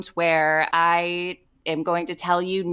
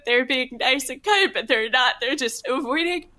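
high, sobbing outpouring; wailing through tears, words tumbling out; tearful breakdown; 6 semitones higher, faster than usual. Acted. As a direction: break down crying as you confess. Breaks down crying, listener says calm down.

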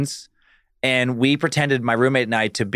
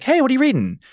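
bright, high, eager hello to a stranger; cheerful high small-talk opener; friendly greeting; 10 semitones higher, faster than usual. Retold or imitated. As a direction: chirp it to a stranger on the train. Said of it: "start talking to people like".

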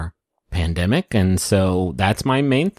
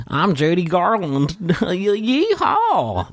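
mock Midwestern twang; 9 semitones higher, slower than usual. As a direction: ham up a yokel yeehaw. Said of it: "chock full of Midwestern twang".

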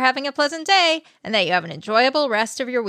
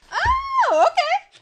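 much higher, louder delighted thanks; excited thanks; 5 dB louder than usual. Real, not acted. Friend thanking her friend for burgers, excited and high. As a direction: gush over a surprise present.

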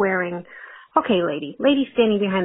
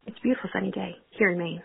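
ordinary delivery

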